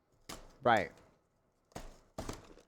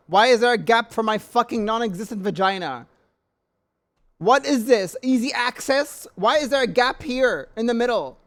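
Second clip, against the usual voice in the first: loud, higher-pitched incredulous questions; outraged rant; 4 semitones higher, 4 dB louder than usual. Real, not acted. Ranting at the gap in the table, outraged. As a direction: fire off indignant questions, voice raised.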